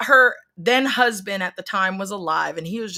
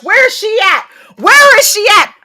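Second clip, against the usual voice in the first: furious demand; 11 semitones higher, 10 dB louder than usual. Retold or imitated. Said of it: "I was just like".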